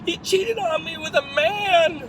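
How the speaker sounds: high, loud, drawn-out mock wail; hammed-up tearful outburst; mock crying. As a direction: imitate a jilted woman bawling. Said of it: "crying her eyes out".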